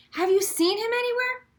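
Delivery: high, urgent plea; desperate high question. Acted. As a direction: grasp at a last chance. Chloe asks, desperate.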